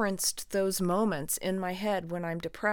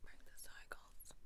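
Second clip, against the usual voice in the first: faint breathy whisper; 24 dB quieter than usual. Pretend. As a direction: barely voice it. Whispered echo of catchphrase.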